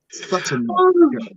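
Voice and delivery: high-pitched mock scold of naughty girl; squealed tease. Teasing her co-host in a high squeal.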